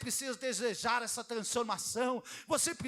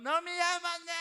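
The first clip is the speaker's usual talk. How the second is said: a higher, louder, put-on wife's voice hurling the accusation; accusing shout; 6 semitones higher, 4 dB louder than usual. Pastor acting Delilah accusing Samson, mock shout. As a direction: throw it at him like a sulking wife.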